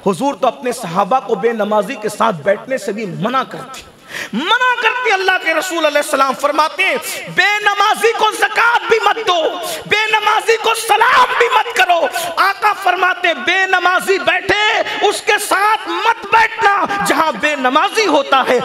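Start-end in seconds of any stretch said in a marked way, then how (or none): 4.3-5.1 much higher, forceful repeat; emphatic cry. Real, not acted. Preacher stresses that the Prophet forbade it, emphatic cry. 5.1-18.6 fervent shouted sermon, word by word; full-force preaching. Real, not acted. Preacher relays the Prophet's ban on non-praying company, full-force preaching.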